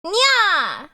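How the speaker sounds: high, loud cat nya; cat girl nya. Pretend. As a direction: pounce on the nya like a catgirl. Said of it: "That doesn't sound like a cat".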